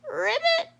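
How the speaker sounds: high, rising startled ribbit; gasped frog ribbit. Acted. Froggy seeing the tower of baskets, high gasped ribbit. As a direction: gasp it out in frog alarm. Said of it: "he gasped".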